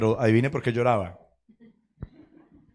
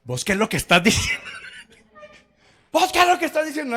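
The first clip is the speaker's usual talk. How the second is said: mock outraged cry, pitched way up; retold indignant outburst. Preacher retells an indignant challenge to the congregation, mock outraged.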